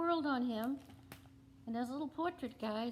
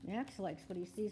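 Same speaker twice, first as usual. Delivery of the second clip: ordinary talk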